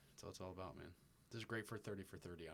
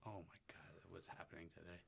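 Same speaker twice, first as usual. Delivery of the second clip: whispering; 10 dB quieter, slower than usual. Real, not acted. Aside in disbelief.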